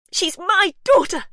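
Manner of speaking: furious yell. Acted. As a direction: scream it as a fierce mother. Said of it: "she yelled".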